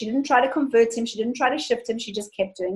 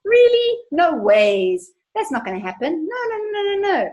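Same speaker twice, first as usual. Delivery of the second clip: incredulous mind voice; 9 semitones higher than usual. Pretend. Host voicing a mind rejecting affirmations, high mock disbelief. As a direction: play the doubting mind scoffing. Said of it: "And your mind's going".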